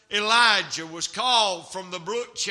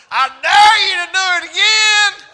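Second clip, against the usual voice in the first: put-on loud, high kid's holler, daring him; boy hollering; 9 semitones higher, 10 dB louder than usual. Little boy in the service hollers a dare, high and loud. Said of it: "he hollered".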